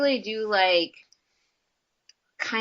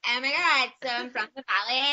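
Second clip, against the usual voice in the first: put-on valley girl; faster than usual. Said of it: "there's people that talk like that".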